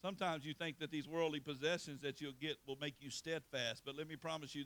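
normal talking voice

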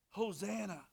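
normal talking voice

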